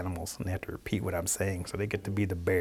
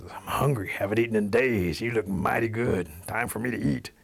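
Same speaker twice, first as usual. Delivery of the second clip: slightly raised, relishing each word; hungry wildcat. Acted. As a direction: play a hungry predator sizing up prey. Storyteller plays hungry wildcat.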